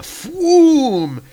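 loud, high, drawn-out whoosh; whooshing blast. Acted. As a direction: imitate an energy bolt firing. Voices the hammer's sound effect.

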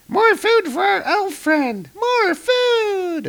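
very high, slow, gleeful squeak; fairy voice. Acted. Fairies force-feeding dark elf friend, very high fairy voice. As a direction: sing it out in a tiny squeaky voice.

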